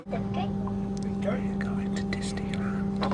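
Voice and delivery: hushed whisper. Real, not acted. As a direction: whisper so nobody hears. Whispering on a quiet train.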